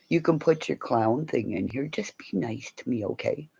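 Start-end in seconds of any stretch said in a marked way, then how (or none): none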